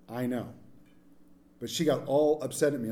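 ordinary talk

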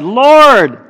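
calls Lord! out high and loud; loud cry. Retold or imitated. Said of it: "It's loud".

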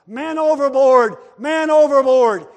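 loud, high shout of alarm; hollered alarm. Acted. Sailor shouting to the crew that a man fell in. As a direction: yell it across the deck.

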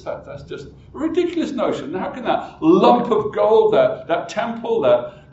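mocking, breezy dismissal; dismissive scoffing. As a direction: brush off the other's fears with scorn. Acting the dismissive freethinker.

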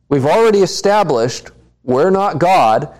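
ordinary talk, nothing marked

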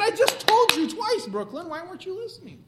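high, loud scolding; exasperated yell. Retold or imitated. Parent yelling at daughter who ignored him, exasperated. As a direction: scold her loudly for not listening. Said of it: "I said".

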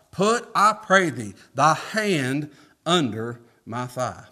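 preacher reading Genesis aloud; preached scripture reading. Acted. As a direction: lean into each word of the verse.

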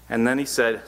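usual speaking voice

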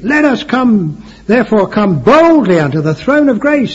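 loud, high scripture proclamation from the pulpit; preaching. Real, not acted. Preacher urging the congregation to come boldly, preaching. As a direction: declaim the verse boldly, phrase by phrase.

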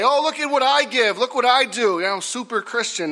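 high-pitched show-off imitation; mock boasting. Mocks people showing off their giving.

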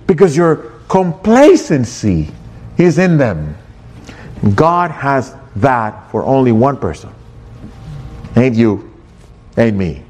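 ordinary delivery

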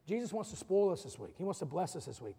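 ordinary talk

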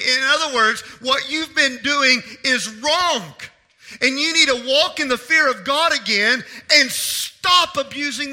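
loud, slow, hammered commands; forceful preaching. Real, not acted. Preacher calling sinners to repentance, forceful and emphatic. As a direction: drive each command home like a call to repent.

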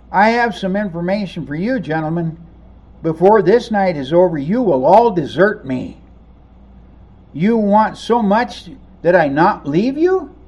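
ordinary talk, nothing marked

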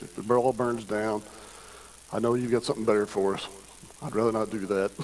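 hushed, low, earnest prayer; quiet prayer. Retold or imitated. Pastor praying over his house before vacation, hushed prayer voice. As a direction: pray it softly, just between you and God.

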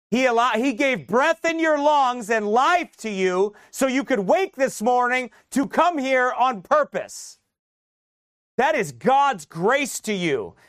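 loud, high, slow preaching that builds as it goes; full-force preaching. Acted. Pastor to congregation, urging them to keep their hearts, preaching at full force. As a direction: drive each phrase home to the congregation.

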